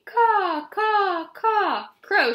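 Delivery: high, loud crow caws; cawing like a crow. Acted. Ada imitating the crow call for practice, loud high caws. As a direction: caw like a crow, then name it. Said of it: "she said".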